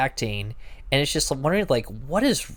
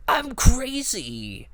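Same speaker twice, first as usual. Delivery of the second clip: high, slow, unhinged voice; crazy Nicolas Cage; 12 semitones higher, much slower than usual. Retold or imitated. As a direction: stretch it out like a madman. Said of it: "where he's just like".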